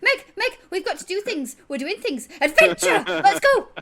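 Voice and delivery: high, loud rush of glee; rally her at full excitement; excited pestering. Acted. Tyne running over to drag Meg along, high loud excitement. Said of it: "Tyne immediately runs over".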